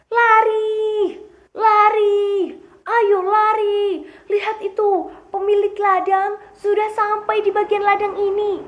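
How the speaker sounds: high, loud shouts to flee; alarmed shouting. Acted. Rabbits warning each other the owner has arrived, alarmed shout. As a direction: yell the warning high and drawn out.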